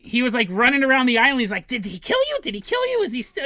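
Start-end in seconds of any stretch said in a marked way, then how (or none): 1.7-3.4 high, frantic, half-mad pleading; frantic crazy guy. Retold or imitated. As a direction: rush it like a frantic madman.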